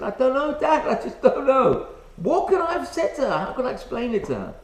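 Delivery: a hint of a bewildered kid's protest; flustered son. Acting out his flustered young son.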